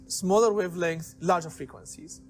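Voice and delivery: ordinary talk, nothing marked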